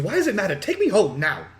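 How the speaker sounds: put-on ostentatious young girl. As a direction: demand it like a spoilt child. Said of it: "she looks to you and says".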